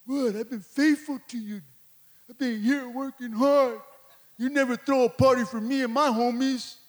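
mildly peeved, whining complaint; hammed-up grievance at normal volume; mock indignant brother. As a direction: play the resentful brother, half in jest. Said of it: "He was all mad".

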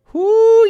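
excited whoop. Real, not acted. Host cheering the Madtown Cross race weekend, excited whoop.

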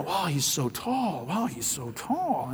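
soft, slow amazed murmur; awed murmur. Retold or imitated. Chinese onlookers marvelling at his height, soft murmur. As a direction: marvel quietly behind his back.

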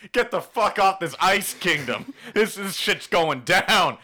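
high, loud warning to all; hammed-up yell, slowing for the last words; mock alarm shout. Retelling a lookout warning everyone off, mock alarm shout. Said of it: "He's telling everyone".

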